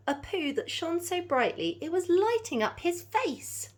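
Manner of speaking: lively storybook read-aloud; animated picture-book narration; reading to children. A narrator's reading. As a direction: bounce the rhymes for little listeners.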